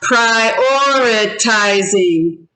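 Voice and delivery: slow "Prioritizing" in five separate beats; syllable by syllable. Shown as a demonstration. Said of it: "Five syllables".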